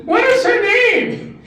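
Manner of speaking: overjoyed father shouting the question; excited yell. Acted. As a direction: yell it down the phone with joy. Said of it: "he's yelling on the phone".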